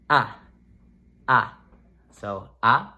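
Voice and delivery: "a" forte e do peito, mais alto; chesty strong A. Shown as a demonstration. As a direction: empurre "a" do peito, com força. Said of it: "the word is a chesty strong word".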